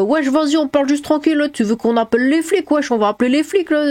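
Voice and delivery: French suburb street slang. Retold or imitated. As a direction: imitate an irritated banlieue guy. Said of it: "il est là en mode". (racaille énervé, voix plus aiguë)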